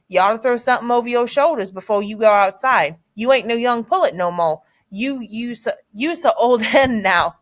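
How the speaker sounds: novel dialogue in Southern dialect; Deep South dialect. Acted. Teacher voicing Jody scolding Janie about her age, Deep South dialect. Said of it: "the dialect that they use".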